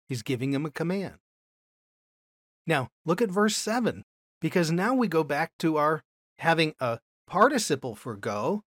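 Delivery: ordinary talk, nothing marked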